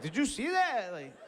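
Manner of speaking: high, laughing mock taunt; cocky bluff. Comic bluffing the other fighter, high laughing taunt. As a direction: taunt to cover the pain. Bluffing after hurting hand.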